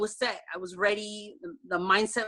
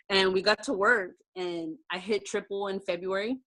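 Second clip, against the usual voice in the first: usual speaking voice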